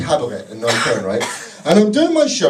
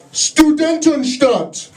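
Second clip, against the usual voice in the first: mock heckle shout; 7 semitones higher, much slower than usual. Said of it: "this guy in the audience shouts out".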